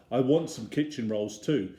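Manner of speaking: usual speaking voice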